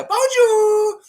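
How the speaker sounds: squeaky high French hello; high falsetto bonjour. Pretend. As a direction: pop it up into falsetto. Sudden high French greeting.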